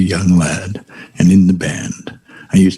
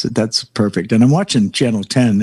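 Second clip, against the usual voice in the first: ordinary talk, nothing marked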